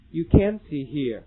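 slow, "can't" with a stopped t and falling tune. Shown as a demonstration. Teacher contrasting can't for the class. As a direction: stop the t in can't and let it fall. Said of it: "大家一起跟我读一下".

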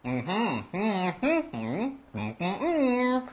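words hummed with lips sealed shut; talking with mouth closed. Shown as a demonstration. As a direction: mumble everything through closed lips. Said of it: "See, watch like this".

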